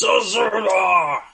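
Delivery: high, drawn-out holler; loud summoning shout, slow and falling; yelling. Acted. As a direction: yell for someone in another room. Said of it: "Drew turns around and yells".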